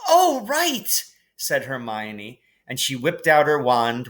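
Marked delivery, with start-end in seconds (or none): 0.1-1.0 very high sheepish oh; a dawning oh right; a touch of realisation; sudden realisation. A narrator's reading. Hermione realising.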